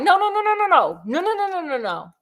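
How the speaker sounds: high, rapid string of no's; frantic denial. Retold or imitated. Sticks denying the scarecrow, a frantic high no no no. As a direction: wave it off in a panicky rush.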